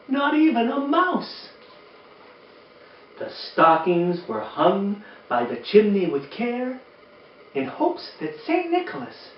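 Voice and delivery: gentle read-aloud storytelling voice; lilting rhymed read-aloud; storybook reading. Acted. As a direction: read it like bedtime to a room of kids. Reading the book aloud to children.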